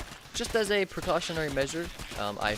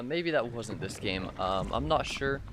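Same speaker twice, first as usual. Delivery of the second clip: ordinary talk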